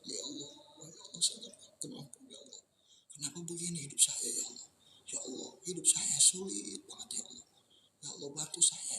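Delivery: high, hushed pleading whisper, voice falling away; acted tearful prayer. Shown as a demonstration. Ustadz acting out a night prayer while weeping. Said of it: "sambil nangis".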